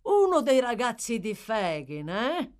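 loud start, rising questioning eh; intrigued exclamation. A narrator's reading. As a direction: exclaim while sizing up a newcomer. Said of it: "esclamò Toby contemplando Oliver".

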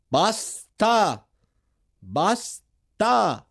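high ringing shout of "Basta!", each one hammered. A narrator's reading. Unarmed soldier shouting at the enemy to stop, loud and high. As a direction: yell it across the trench to stop the firing. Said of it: "gridò in italiano".

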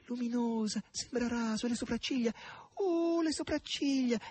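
a maid's high, ecstatic gushing; gushing cries. A narrator's reading. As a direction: exclaim over her beauty while hugging her. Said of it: "baciandola e gridando".

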